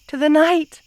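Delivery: a gleeful, possessive cry; exultant claim. Acted. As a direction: claim them for the night. Claiming the beloved.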